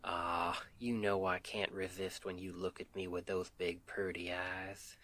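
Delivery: usual speaking voice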